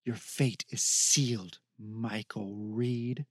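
a distant evil speaking through the beast; demonic voice from the hound. Acted. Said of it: "The woman with the eyes' voice emitted".

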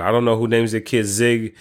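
normal talking voice

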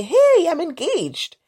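high, slow, bright announcement; surprise engagement news. Retold or imitated. Friend calling out of the blue, announcing an engagement. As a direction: chirp it out like sudden big news.

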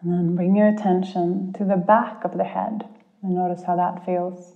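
calm guide easing listeners inward. Acted. Leads a guided meditation.

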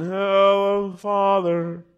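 stiff, solemn mock formality; stiff formal greeting. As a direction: greet him like a stranger, stiffly. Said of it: "you don't go into his presence going".

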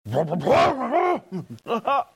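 a high-pitched disbelieving laugh; high burst of laughter. Real, not acted. Preacher laughing as he recalls the dead boat starting. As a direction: laugh out loud at the memory.

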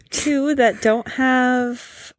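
normal talking voice

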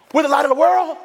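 high, rising, astonished question; as if it just dawned on him; amazed question. Retold or imitated. Robert reading the Bible, stunned by the verse. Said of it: "Robert looks up and he says".

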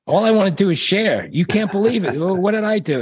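high, gushing enthusiasm; overeager excitement. Retold or imitated. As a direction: play the wide-eyed eager kid. Mocking his own eager self.

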